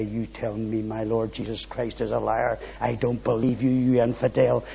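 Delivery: low, hushed telling-off; quiet, deep-pitched confrontation; private rebuke. Acted. Privately rebuking the old nature.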